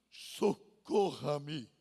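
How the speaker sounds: soft, slow, broken "Socorra-me" in a low voice; humble plea. Acted. Preacher acting a humbled sinner asking God for help, soft slow plea. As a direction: sink down and barely breathe it out.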